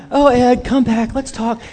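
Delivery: high, pleading mock call; calling after someone. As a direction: re-enact begging someone to come back. Acted calling after fleeing partner.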